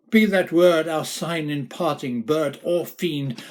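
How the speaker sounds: high, loud shriek; furious shrieking command. Acted. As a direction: fling the words at the fiend. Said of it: "I shrieked".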